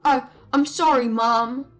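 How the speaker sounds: cowed son. Acted. Husband apologizes to his mother, stammering. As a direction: cringe before his mother.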